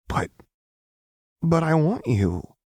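put-on childlike bafflement with a halting start; confused. A narrator's reading. Jinx puzzled by Lux's apology, confused. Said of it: "Jinx sounded confused".

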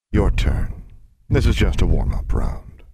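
loud, booming monster voice; riddling sphinx. Acted. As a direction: pose the riddle as a big beast. Sphinx addresses the girl.

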